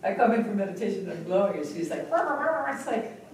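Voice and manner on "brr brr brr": mock grumble. Friend's grumbling imitated.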